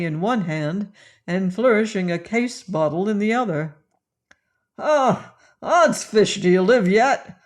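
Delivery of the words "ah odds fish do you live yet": loud, slurring pirate bellow; drunken roar. A narrator's reading. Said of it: "roared he in a drunken frenzy".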